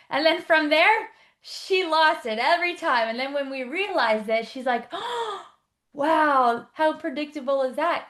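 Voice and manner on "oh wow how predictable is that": amazed realization. Retold or imitated. Client realizes, quoted.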